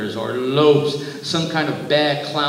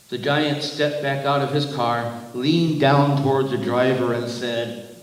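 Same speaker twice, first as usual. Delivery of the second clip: usual speaking voice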